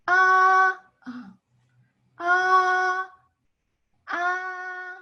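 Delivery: "ah" held level on a high note; first tone. Shown as a demonstration. Teacher relates first tone to English, high level "ah". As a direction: sustain a high, steady "ah". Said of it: "For the first tone".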